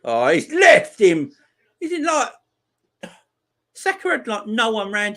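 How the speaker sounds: loud, high cry of disbelief; exasperated outburst. Real, not acted. Fan watching a defender lose his man, exasperated outburst. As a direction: blurt it out, pitch leaping up.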